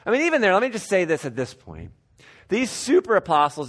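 ordinary talk

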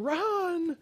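small panicked conscience; little inner voice. Pretend. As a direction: squeak it like a tiny conscience. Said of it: "this little voice saying".

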